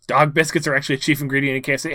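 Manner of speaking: loud, fast excited claim; excited blurt. Acted. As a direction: blurt it out quickly. Character provokes.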